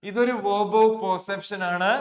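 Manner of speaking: "verb of perception" said slowly and loudly, each word apart. Shown as a demonstration. Teacher drilling the class on the term, slow and loud. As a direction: drive the term home word by word. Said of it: "അപ്പൊ ശ്രദ്ധിച്ചേ".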